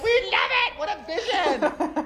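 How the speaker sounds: hammed-up adoring fan, laughing; mock gushing fans. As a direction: gush like a dazzled critic. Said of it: "they're like".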